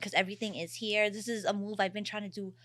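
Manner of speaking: normal talking voice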